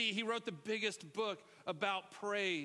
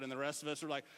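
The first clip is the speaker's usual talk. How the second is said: ordinary talk, nothing marked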